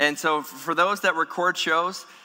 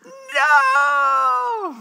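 put-on shrill toddler yell, much higher and louder; toddler tantrum; 6 dB louder than usual. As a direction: scream it like a defiant toddler. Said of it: "Does this not sound like a toddler?".

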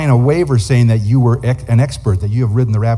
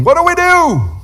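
high, loud yell to the captain; yelled question; 4 dB louder than usual. Retold or imitated. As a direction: shout it over the roaring rapids, pitched way up. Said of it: "so I yelled back at the captain".

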